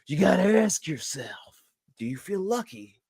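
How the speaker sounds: drawn-out tough-guy movie impression; slow, menacing challenge with long pauses; Jim Carrey's Clint Eastwood. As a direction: play the gunslinger, stretching each phrase. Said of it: "Jim Carrey did this impression".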